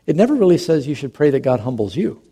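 ordinary talk

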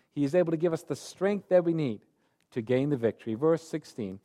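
ordinary talk, nothing marked

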